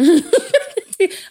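bursting into a high, sudden laugh; real laugh. Real, not acted. Host laughs at the guest's story of his struggling father, real laugh.

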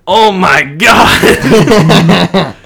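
loud, high, drawn-out gasp of words; horrified grandma. Retold or imitated. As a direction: stretch it out in hammed-up shock. Grandma's shock at lost key.

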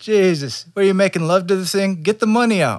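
high, loud exasperated yell; angry shout. Pretend. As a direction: yell at the clumsy student. Mock instructor yelling at student.

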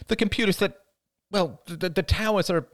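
high, breezy, improvising mother; airy. A narrator's reading. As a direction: fumble lightly for an explanation for a child. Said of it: "replied his mother airily".

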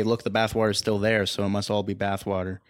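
normal talking voice

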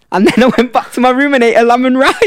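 high, loud laughing punchline; real laughter breaking into the story; laughing confession. Real, not acted.

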